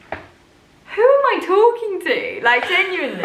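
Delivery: high, amused disbelief; laughing incredulously. Real, not acted. Laughing at own diary.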